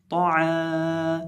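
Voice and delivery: तो आ chanted very slowly, long vowel held; drawn-out recitation. Shown as a demonstration. Teacher models the syllable for students, slow chant. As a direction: stretch तो आ like Quran recitation. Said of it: "आइन ज़बर अलिफ आ".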